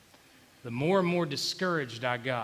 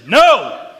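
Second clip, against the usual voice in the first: loud, high, punched shout; booming objection from the pulpit; emphatic refusal; 6 semitones higher, 17 dB louder than usual. Real, not acted. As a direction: shout it down as a firm correction. Emphatic correction of the imagined congregant.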